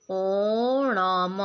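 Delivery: ओणम stretched out very slowly; slow classroom demo of ओणम. Shown as a demonstration. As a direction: draw out ओणम sound by sound. Teacher spells out word slowly.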